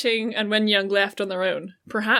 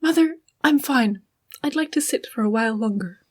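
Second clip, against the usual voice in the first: gentle daughter voice; 2 semitones higher than usual. Acted. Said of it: "can I be Yanli".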